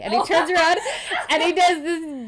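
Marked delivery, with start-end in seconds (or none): 0.0-0.8 laughing. Real, not acted. Laughing while telling funny scene.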